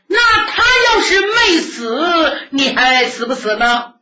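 high, loud, tearful challenge; old nun challenging. Acted. Old nun testing the young man's vow, loud and high.

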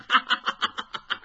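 breathy open ha-ha laugh; hearty laughter. Acted. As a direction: laugh out with delight. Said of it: "程咬金一听这个乐呀".

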